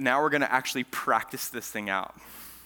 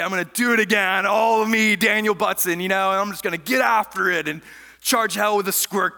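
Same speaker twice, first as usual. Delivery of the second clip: loud, high, psyched-up boast; hyping yourself to charge hell; pumped-up resolve; 7 semitones higher, 7 dB louder than usual. Retold or imitated. Pumped self-talk quoted.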